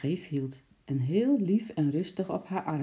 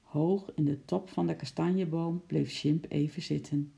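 plain reading voice

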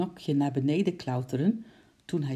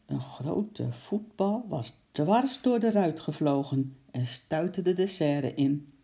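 usual speaking voice

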